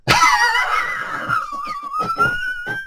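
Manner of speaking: long, high burst of laughter; big laugh. Real, not acted. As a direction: howl with laughter. Laughs at the bloke's lie.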